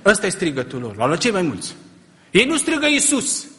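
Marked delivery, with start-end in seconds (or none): 2.3-3.5 high, loud preaching cry; emphatic shout. Real, not acted. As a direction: shout it out to the church. Contrasting shouts, sermon.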